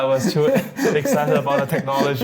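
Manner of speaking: words squeezed out through high-pitched laughter; laughing. Real, not acted. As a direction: laugh at yourself while you say it. Laughs about missed token buys.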